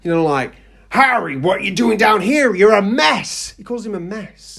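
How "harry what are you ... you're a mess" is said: Hagrid scolding. Retold or imitated. Hagrid scolding Harry in Knockturn Alley, loud and high. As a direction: tell him off like a disapproving giant.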